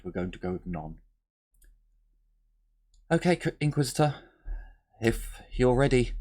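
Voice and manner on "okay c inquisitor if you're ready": put-on sergeant on the comms in a light American accent; a hint of American twang on "Inquisitor, if you're ready". Sergeant over comms to the Inquisitor, light American accent. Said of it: "Did you all like the American accent".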